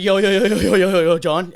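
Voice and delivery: loud, urgent string of yos; panicked calling out. Retold or imitated. Suddenly realizing the accident, calling loudly to John. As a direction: call out to him in alarm. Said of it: "I was like".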